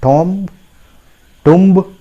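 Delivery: "Tom" with open o, then "Tumb" with sounded b; wrong pronunciations. Shown as a demonstration. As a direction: show both misreadings, "Tom" and "Tumb". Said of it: "that is not the correct pronunciation".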